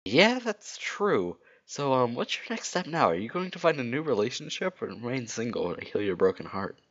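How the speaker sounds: high-pitched cartoon hero; Sonic's character voice. Sonic asks Rogue about her next step, high-pitched voice. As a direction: lift it well up into Sonic's voice. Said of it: "Whose voice is that?".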